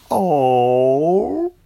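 loud, drawn-out sad oh; a crowd of oranges let down; disappointed chorus. A narrator's reading. Oranges disappointed at the girl's refusal, loud.

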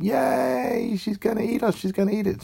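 very high, excited squeaky cheer; a crowd of oranges delighted. A narrator's reading. Oranges cheering that the girl will eat them, high.

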